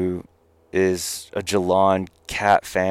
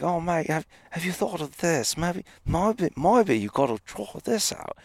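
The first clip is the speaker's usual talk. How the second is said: put-on much higher Australian accent, friendly mate suggesting an idea; Aussie accent; 10 semitones higher than usual. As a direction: pitch it up into a cheery Australian accent. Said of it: "I wouldn't do his accent in justice".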